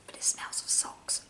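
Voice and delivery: hushed joke whisper. Acted. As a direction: whisper the punchline. Whispered joke about socks.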